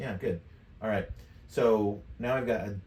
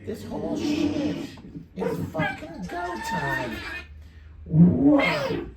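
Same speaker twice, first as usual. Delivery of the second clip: extremely high pitch-shifted chatter; super high voice. Shown as a demonstration. Streamer fiddling with buttons, super high voice effect. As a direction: talk through a tiny, squeaky pitch effect. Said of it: "it's super high voice".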